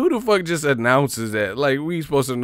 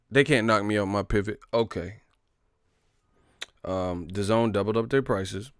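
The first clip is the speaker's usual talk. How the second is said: usual speaking voice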